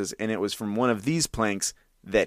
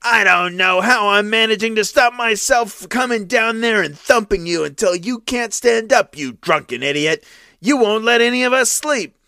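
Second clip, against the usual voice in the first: higher, loud furious thug; harsh threat; 10 semitones higher, 5 dB louder than usual. A narrator's reading. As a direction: threaten to thump him. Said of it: "said more harshly than ever".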